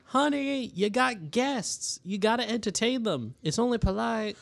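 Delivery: high voice calling up the stairs; calling upstairs. Acted. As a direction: call up to her husband. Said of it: "almost shouting".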